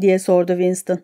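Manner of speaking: plain reading voice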